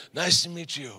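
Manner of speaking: soft-spoken tough-guy imitation; Glenn. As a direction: mimic the intimidating man's quiet greeting. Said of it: "this is exactly how he talks".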